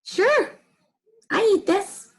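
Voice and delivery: high, offhand pig voice; lightly high Piggy; casual Piggy. Acted. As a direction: shrug it off, high and easy.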